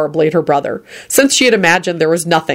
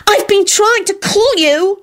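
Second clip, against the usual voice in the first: high, slow holler; a high drawn-out holler; slower than usual. Acted. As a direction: call him out, exasperated. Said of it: "she hollered at Quentin".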